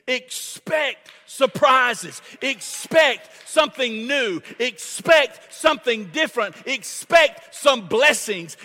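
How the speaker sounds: loud, rising sermon call; rally the congregation, line by line; preaching at full force. Acted. Preacher urging the church to expect surprises, loud rousing cadence.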